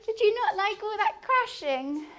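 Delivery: cooing at pet. Real, not acted.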